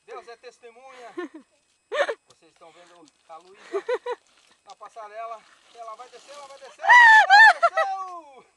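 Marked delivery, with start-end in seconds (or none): 2.3-8.5 live commentary. Pretend. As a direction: hype it up like a sports caster. Mock live commentary, climax.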